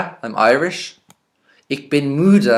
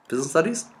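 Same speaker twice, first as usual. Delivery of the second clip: usual speaking voice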